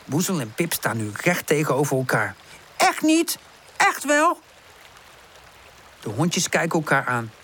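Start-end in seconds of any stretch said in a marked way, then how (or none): 2.8-3.4 high, heated contradiction; pitched-up quarrelling; squabbling denial. A narrator's reading. Face-to-face quarrel, Pip denies. 3.8-4.4 high contradiction with rising end; pitched-up quarrelling; squabbling comeback. A narrator's reading. Face-to-face quarrel, Woezel insists.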